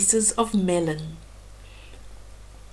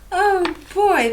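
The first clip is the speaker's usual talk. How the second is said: usual speaking voice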